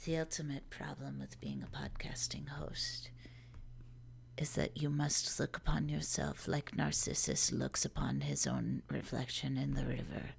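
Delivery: put-on low, hushed German-accented gloom; Werner Herzog. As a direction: whisper bleak philosophy with German gravity. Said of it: "like, the Werner Herzog voice".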